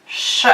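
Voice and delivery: sh said as a long hushed hiss; teaching the sh sound slowly. Shown as a demonstration. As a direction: blow out a steady sh. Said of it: "Can you repeat after me".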